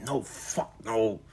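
very soft, half-whispered refusal; hushed denial. Acted. Michael denying his own ecstasy to himself, hushed denial. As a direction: mutter it under your breath.

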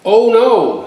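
high, startled oh no; dismayed surprise. Acted. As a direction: jump up in pitch at the broken fence. Farmer sees broken fence.

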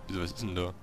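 ordinary delivery

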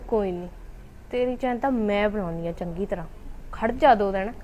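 low-pitched private threat; scheming threat aside. Acted. Jealous sister-in-law vowing to fix the chain owner, scheming aside. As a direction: drop low and promise payback to yourself.

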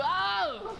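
slow, drawn-out startled cry. Real, not acted. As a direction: caught off guard, stretching the oh. Reacts to sudden bright light.

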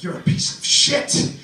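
mock the angry man yelling in his face; retold screaming. Comic imitating the furious bar patron, mock yelling.

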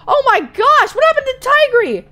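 high, loud disbelief; high-pitched, loud freak-out; shocked outcry. Real, not acted. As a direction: cry out aghast at the sight. Shocked reaction to the game.

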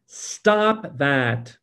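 a stern "Stop that." told to yourself; firm rebuke. Shown as a demonstration. Preacher demonstrates telling the soul to stop, in a stern rebuke. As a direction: scold your wild soul. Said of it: "You must say to your soul".

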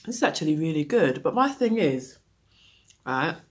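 speaking with food in mouth; talking between bites; chewing while talking. Real, not acted. Seen 0: Holds bar at mouth while speaking; bites again at +3.0.

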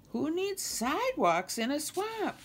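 high-pitched, indignant question; incredulous protest. Acted. Kermit objects to Nemo's sidewalks, incredulous protest.

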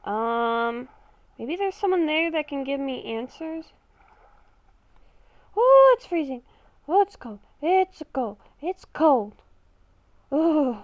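ordinary talk, nothing marked

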